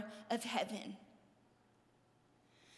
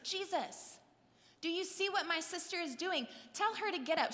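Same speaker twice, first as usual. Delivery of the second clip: higher-pitched indignant tattling; Martha storming in; 5 semitones higher than usual. Retold or imitated. Sister storms in to complain to Jesus, high-pitched mock indignation. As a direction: imitate an outraged sister demanding backup. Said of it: "She storms in there and she's like".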